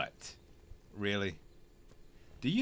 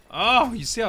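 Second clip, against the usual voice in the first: sudden surprised outburst; 10 semitones higher, 9 dB louder than usual. Real, not acted. Sudden exclamation.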